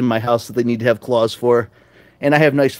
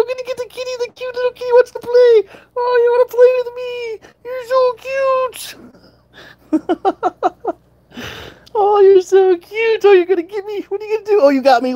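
high, sing-song pet voice; baby talk to cats; 3 dB louder than usual. Acted. Owner cooing to her cats, high baby talk. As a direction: coo at the cat like a baby.

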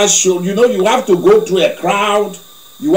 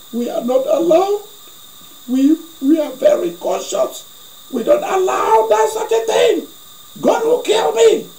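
frightened pleading; 13 semitones higher than usual. Retold or imitated. Man pleading with the woman.

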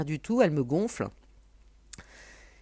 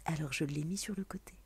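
whispered aside; 5 dB quieter than usual. Pretend. Showing Pépé the figurine to viewers, whispering. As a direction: whisper it softly and playfully.

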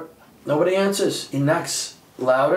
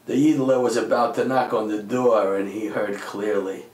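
ordinary talk, nothing marked

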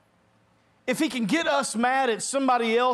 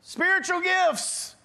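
put-on high, far-off yell of a drifting kid; 9 semitones higher than usual. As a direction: shout it out from the river. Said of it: "he's yelling out".

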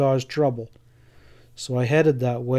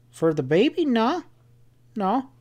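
high, assured dismissal; quite sure; 9 semitones higher than usual. A narrator's reading. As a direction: brush the worry off, voice pitched up. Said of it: "Sam sounded quite sure about it".

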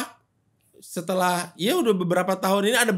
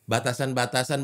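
ordinary talk

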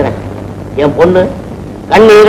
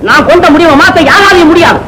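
furious shout; 9 semitones higher than usual. Acted. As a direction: shout him down, no mercy. Father refuses, throwing him out. (உரத்த கோபக் கத்தல்)

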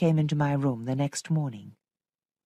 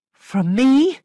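high, loud, rising disbelief; shocked question; 9 semitones higher, 10 dB louder than usual. A narrator's reading. Governess hearing Flora's rejection, shocked question. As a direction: ask it aghast, pitch climbing.

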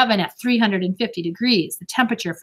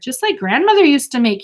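raised, grand declaration; pronouncing; 6 semitones higher, 5 dB louder than usual. A narrator's reading. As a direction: pronounce it like a verdict. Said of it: "he pronounced".

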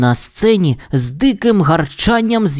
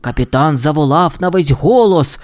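ordinary delivery, nothing marked

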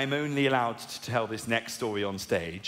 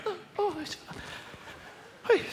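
soft, high imitation of finishing; halting, hushed moans around broken words; mock climax; 6 dB quieter than usual. Comic re-enacting himself in bed, high mock climax. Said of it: "I heard myself finishing, and as I came".

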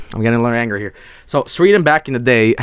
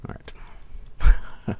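whisper; 6 dB quieter than usual. Real, not acted. Whispered after the scare.

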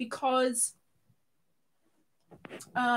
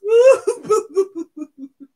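loud hooting laugh; acted-out emoji laugh; 4 semitones higher, 15 dB louder than usual. Streamer voicing a viewer's emoji, loud mock laugh. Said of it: "That's her emoji, not me".

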